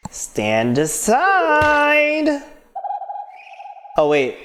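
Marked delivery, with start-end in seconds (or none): 0.1-2.4 high, long-drawn heroic command; Steiner. Acted. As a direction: draw the order out high and grandly. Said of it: "that was Steiner saying".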